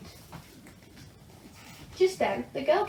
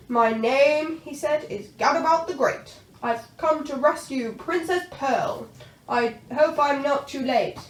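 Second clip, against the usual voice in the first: louder, swaggering knight; Gadabout the Great; 2 semitones higher, 6 dB louder than usual. A narrator's reading. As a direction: announce himself heroically. Knight introduces himself boldly.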